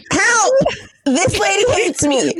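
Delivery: high-pitched plea, stretched out at the end; mock cry for help. Clerk re-enacts appealing to the store, mock cry for help. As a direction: cry out for rescue, hammed-up.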